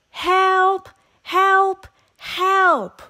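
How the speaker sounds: high, loud calls for help; frightened cry for help. A narrator's reading. Stuck little truck crying to everyone for help. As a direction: call out like a scared little truck. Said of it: "cried the little blue truck".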